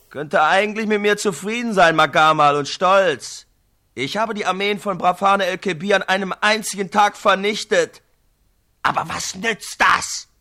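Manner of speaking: a touch of proud, then weary hero; boast, then turn doubtful; lightly voiced Mafaka. Mafaka tells his brother of his victory, proud then doubting.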